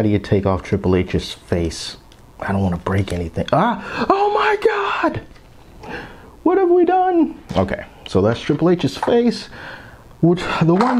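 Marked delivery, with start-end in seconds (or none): 3.5-7.4 very high squealed panic; hammed-up falsetto dismay; mock horror. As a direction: squeal in pretend horror at what you just did. Mock horror at pulling toy's face off.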